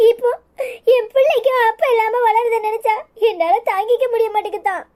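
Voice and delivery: sobbing lament with gasping breaths; tearful despair; crying. Acted. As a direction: weep helplessly between phrases. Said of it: "அழாதம்மா".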